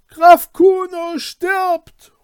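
high, slow desperate shout; dying cry. Acted. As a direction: cry it out with the last breath. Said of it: "rufen konnte".

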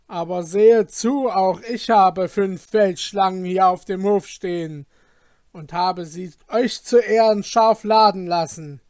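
loud, high commanding threat; thundering voice. Acted. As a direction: thunder down from the window like a lord. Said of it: "mit donnernder Stimme".

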